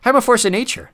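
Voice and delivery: no regrets at all; unapologetic. Acted. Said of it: "said Mulch unapologetically".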